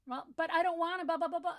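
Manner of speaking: put-on high, loud whining kid, a bit fast; mock a preschooler who won't let it go; child whining back at mom; whiny child. Child keeps debating the parent.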